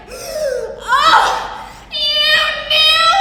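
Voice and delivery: gasping, high and loud realization; a laughing squeal of discovery; delighted shock. Retold or imitated. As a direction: shriek it as it dawns on you. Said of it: "He's like, gasps".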